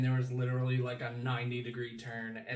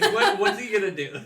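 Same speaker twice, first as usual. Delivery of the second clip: high, laughing incredulous question; amused scoff; laughing disbelief; 8 semitones higher, 11 dB louder than usual. Real, not acted. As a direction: laugh at how absurd it is. Mocking disbelief.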